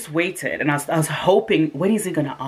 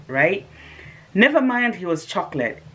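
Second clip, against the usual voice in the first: ordinary talk, nothing marked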